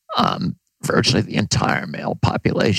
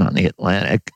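ordinary talk, nothing marked

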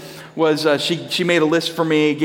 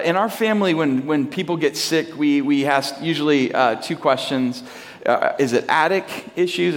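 usual speaking voice

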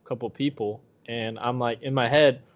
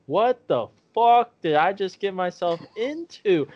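slow, higher-pitched retold astonishment; bewildered disbelief; 5 semitones higher, slower than usual. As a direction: stretch it out, dumbfounded. Retold bewilderment after the fight.

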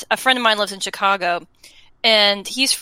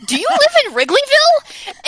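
gasping at the stadium behind the house; shocked disbelief; 11 semitones higher, 3 dB louder than usual. Retold or imitated. Telling a friend, reliving spotting Wrigley Field: stunned, much higher.

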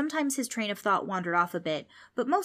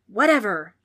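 dismissive wail; 2 semitones higher, 10 dB louder than usual. A narrator's reading. Ghost giving up on the word, dismissive wail. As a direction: wail it loudly, brushing it off. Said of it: "wailed the ghost".

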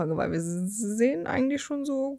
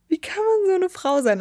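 higher, indignant question; outraged disbelief; 9 semitones higher than usual. Real, not acted. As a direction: ask it in shocked disbelief. Incredulous repeat, second take outraged.

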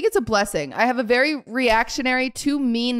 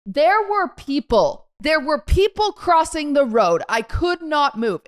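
slow, higher, indignant protest; retold aggro; 5 semitones higher, slower than usual. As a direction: spell it out like an angry driver, mock-aggressive. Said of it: "aggro, and I say".

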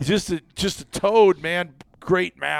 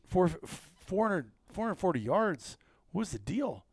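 soft, halting private question; hushed disbelief; 3 semitones higher, 9 dB quieter than usual. Retold or imitated. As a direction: ask a buddy quietly, baffled. Teller replays confiding question to friend.

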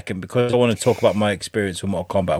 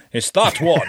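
mock sheriff; 6 semitones higher than usual. Mock sheriff pointing out culprit.

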